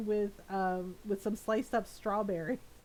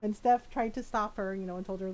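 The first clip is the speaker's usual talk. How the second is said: ordinary talk, nothing marked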